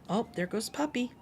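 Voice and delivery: usual speaking voice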